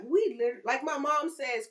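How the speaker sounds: normal talking voice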